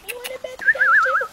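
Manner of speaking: excited high-pitched squeal. Real, not acted. Squealing at puppy.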